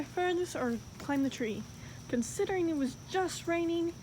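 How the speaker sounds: ordinary delivery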